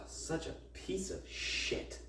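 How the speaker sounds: slow, breathless whisper; whispered insult. Real, not acted. Cursing the condescending teacher in a slow whisper. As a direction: hiss it out slowly under your breath.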